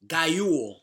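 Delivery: normal talking voice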